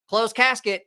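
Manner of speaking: high-pitched quoted catchphrase; retold game catchphrase. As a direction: call it out high, replaying yourself. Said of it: "I heard myself saying it".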